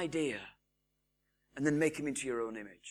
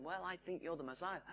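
hushed retold answer; 13 dB quieter than usual.

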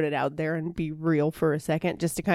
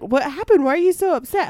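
higher, louder puzzled questions; retold alarm, pitched up; Hagrid's concerned questioning; 8 semitones higher, 6 dB louder than usual. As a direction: imitate a bewildered partner asking what's wrong. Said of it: "Hagrid was like".

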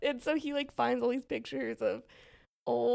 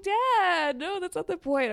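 protesting to dad; 8 semitones higher, 8 dB louder, slower than usual. Retold or imitated. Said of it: "I'm like".

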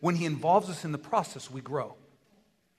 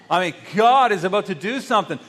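louder, showing how it should sound; enthusiastic; 2 semitones higher, 7 dB louder than usual. Shown as a demonstration. Preacher shows the church real excitement. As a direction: say it like you mean it. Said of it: "that was not enthusiastic at all".

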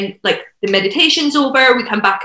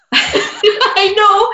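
laughing through tears, high and fast; emotional laughing; 10 semitones higher, 3 dB louder than usual. Real, not acted. Moved by her own story with the host, teary laughter. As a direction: laugh it off while choked up.

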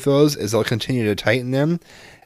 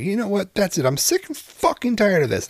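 mock tantrum, voice pitched way up; retold exasperation; 12 semitones higher than usual. Acted quarrel with huffy son.

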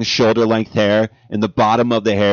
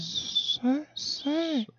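very high falsetto voice; 12 dB quieter than usual. Pretend. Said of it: "Trying to find the range".

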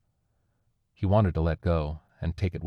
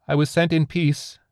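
high, earnest entity voice; pleading alien; 10 semitones higher, 4 dB louder than usual. A narrator's reading. Alien answers survivor from the green glow, high pleading. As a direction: declare it across the meadow.

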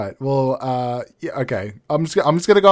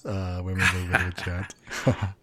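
dropped low and soft, muttered; quiet aside; 6 semitones lower, 8 dB quieter than usual. Shown as a demonstration. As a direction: throw it away under the breath. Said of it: "repeats his own line".

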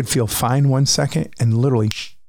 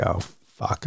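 low, much softer, sinking; quiet dread; 5 semitones lower, 7 dB quieter than usual. Retold or imitated. Watching the medic's eyes widen, a quiet frightened curse. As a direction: let it drop out in sudden fear. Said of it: "And I'm like".

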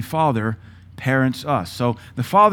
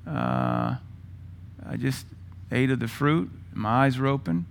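softer, hesitant guilty admission; ashamed confession; 6 dB quieter than usual. Retold or imitated.

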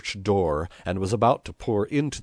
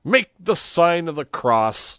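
high, loud, slow command; severe order; 11 semitones higher, 7 dB louder than usual. A narrator's reading. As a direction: order the crowd with stern force. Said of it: "ordered them severely".

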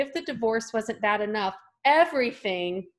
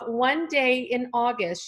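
ordinary talk, nothing marked

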